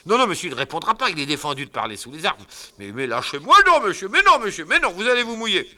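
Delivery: high loud fast pleading; frantic gabble. Acted. Fadinard frantically fending off the husband, fast and loud. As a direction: rush the excuses out.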